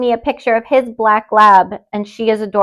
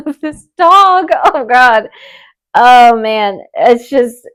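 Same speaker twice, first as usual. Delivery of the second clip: laughing to tears; 4 dB louder, slower than usual. Real, not acted. Host picturing tears over a stranger's dog, laughing to tears. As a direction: break up laughing as you say it. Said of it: "I feel like I'm tearing up".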